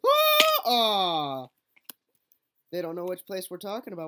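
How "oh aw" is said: mock wail. Real, not acted. Reacting to a fall.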